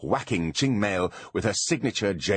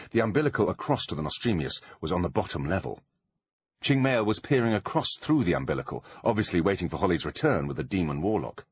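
plain reading voice

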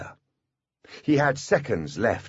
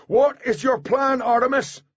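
strained shout for an answer; urgent demand; 4 dB louder than usual. A narrator's reading. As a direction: call the question out desperately. Urgent question called through the dome.

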